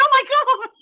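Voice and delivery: high, loud mock shock; retold shocked reaction. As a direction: exclaim it high and loud, hammed-up.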